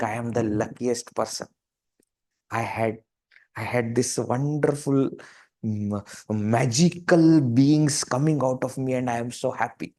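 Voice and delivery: put-on soft, hushed delight at the children; blissful parent. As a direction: murmur it like a proud, happy parent. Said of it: "then you think".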